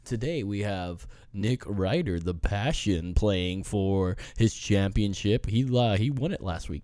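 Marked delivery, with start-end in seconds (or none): 0.0-5.4 slow low game show announcer; game show host. Acted.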